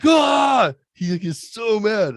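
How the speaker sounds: shouting how mad the son got; excited shout. Real, not acted. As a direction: blurt it out at the top of your voice. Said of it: "laughing excitedly retelling".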